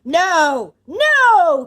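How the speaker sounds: booming refusal climbing high; enraged, drawn-out bellow; furious cry. A narrator's reading. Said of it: "cried Bluebeard".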